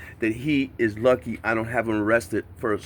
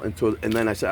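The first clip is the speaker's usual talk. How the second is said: ordinary delivery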